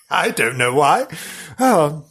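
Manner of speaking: ordinary delivery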